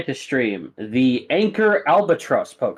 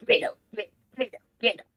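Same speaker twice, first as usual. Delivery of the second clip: higher, squeaky Pokemon call; creature chirp; 10 semitones higher, 5 dB quieter, much faster than usual. Acted. Stumpy Pokemon waddles out squeaking its name. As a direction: chirp it like a tiny critter. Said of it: "he's like".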